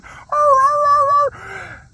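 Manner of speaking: very high, loud mock dog screams; piercing run of yelps, dropping off at the end; imitated screaming dog. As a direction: howl like a dog screaming bloody murder. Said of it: "he's just screaming bloody murder".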